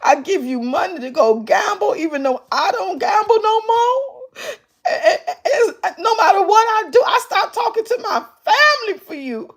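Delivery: high, wailing mock sobs; mock crying. Mocking the crying caller.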